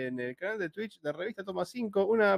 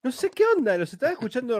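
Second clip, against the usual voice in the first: surprised; 8 semitones higher, 6 dB louder, faster than usual. Real, not acted. Puzzled about lost audio.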